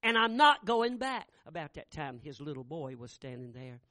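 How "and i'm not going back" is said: loud, slow, stubborn shout; bitter loud defiance, every word slow; angry refusal. Retold or imitated. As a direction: slam the door on the idea. Roy angrily refuses church.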